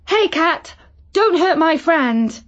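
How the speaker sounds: put-on a girl protects her friend from a cat; high urgent plea. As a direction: stand up to the big cat. Girl confronts big cat.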